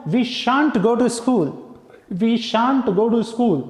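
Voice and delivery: "shan't" said slowly and clearly; slow careful demo. Shown as a demonstration. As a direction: dictate word by word. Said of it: "क्या बोलूंगा". (धीमे, साफ़ उच्चारण)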